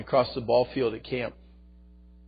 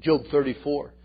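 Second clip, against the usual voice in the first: usual speaking voice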